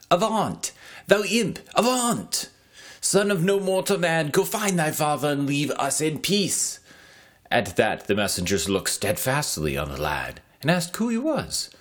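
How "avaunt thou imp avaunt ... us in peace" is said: put-on shouting boys. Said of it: "heard them shout".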